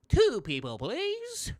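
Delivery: please swoops up very high; rising please. Shown as a demonstration. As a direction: sing the please upward. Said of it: "So two people, please".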